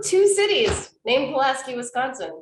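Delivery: animated, high-pitched Wisconsin accent. Real, not acted. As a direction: let the Wisconsin accent come out. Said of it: "my Wisconsin accent just came out".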